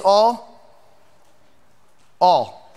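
all, set apart and pressed; emphatic all. Real, not acted. Pastor answering his own question about all, emphatic. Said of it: "how that word translates".

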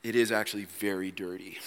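dropped low and quiet; hushed side remark; soft aside. Real, not acted. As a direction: murmur it as a private comment. Quiet aside after outburst.

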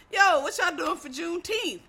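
imitating an enthusiastic teacher; upbeat acted question. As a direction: toss it out eagerly to the class.

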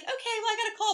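mock high voice for a quick excuse; imitating her brush-off. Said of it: "She was like".

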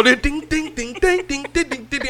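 imitated phone chime. Dreading the memory jingle behind his mother's photo. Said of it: "hear that song behind my mama".

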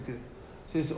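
ordinary talk, nothing marked

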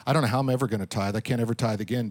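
low, quiet, rushed fretting; mock despair. Preacher voicing a broke believer who gave up tithing. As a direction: hurry through a hopeless excuse. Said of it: "you think".

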